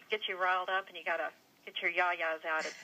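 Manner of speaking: ordinary talk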